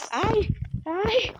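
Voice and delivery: sudden high shriek of fright; startled yelp. Real, not acted. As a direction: jump scare cry. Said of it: "Ai, que susto".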